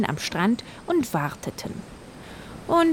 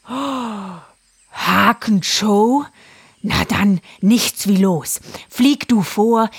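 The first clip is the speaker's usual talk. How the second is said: jaguar voice; 4 semitones higher, 6 dB louder, slower than usual. Acted. Jaguar character voice.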